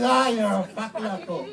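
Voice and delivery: deadpan insult. Pretend. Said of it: "it just goes".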